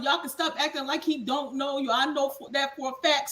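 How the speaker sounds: ordinary talk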